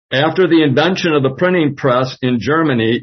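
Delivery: normal talking voice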